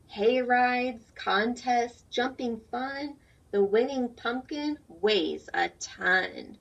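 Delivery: slow, sing-song rhyming read-aloud; reading to children. A narrator's reading. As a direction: read the rhyme slowly for little ones. Reads picture book aloud.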